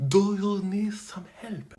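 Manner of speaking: slow, whispered mock Arabic accent on "Do you need some help?". [name] retrying her fake accent on the refugee family, slow and hushed. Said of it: "make my accent deeper".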